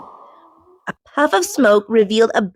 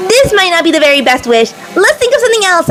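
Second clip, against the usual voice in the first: loud; 8 semitones higher, 6 dB louder, faster than usual. A narrator's reading. Shouting during wild flight.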